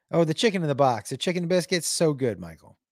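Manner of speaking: usual speaking voice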